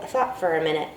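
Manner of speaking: plain reading voice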